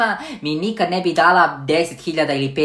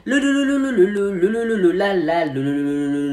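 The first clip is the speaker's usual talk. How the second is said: singing la la through a robot voice changer. Acted. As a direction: sing nonsense syllables in a robot filter. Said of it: "tako zvučim kao robot".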